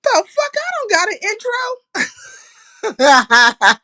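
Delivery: high, fast mock outrage; hammed-up jealous whine; mock indignant. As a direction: squeal it like you're offended. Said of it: "I said".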